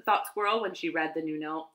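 plain reading voice

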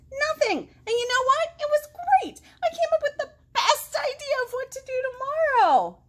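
high, loud, beaming little frog; excited frog. Acted. Frog tells dad excitedly.

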